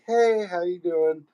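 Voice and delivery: slow, high sweet hey for the woman; greeting a woman who walks in, sweetly high; voice for women. Retold or imitated. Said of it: "I'm like".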